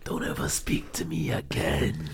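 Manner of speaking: low, slow, breathy whisper of a threat; low whispered threat, drawn out; menacing film quote. Retold or imitated. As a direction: say it cold and hushed, like a quiet warning. Quoting the film character's line.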